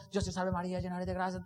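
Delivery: low, hushed monotone recitation; rosary prayer. Retold or imitated. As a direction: murmur it like a routine Hail Mary. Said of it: "El rosario, mi hijo, es poderoso". (rezo del rosario, bajo y monótono)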